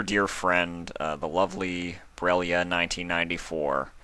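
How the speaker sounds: ordinary delivery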